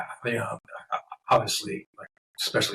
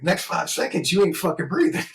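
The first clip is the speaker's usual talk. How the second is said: mock threat; 8 semitones higher, 4 dB louder than usual. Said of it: "I was like".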